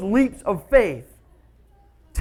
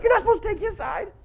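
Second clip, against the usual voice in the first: whiny complaint; 12 semitones higher than usual. Retold or imitated. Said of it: "I'm like".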